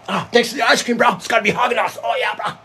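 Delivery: high, over-excited mock streamer; streamer parody. Parodying a streamer thanking fans for ice cream, high gushing. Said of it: "I'm gonna do a parody on it".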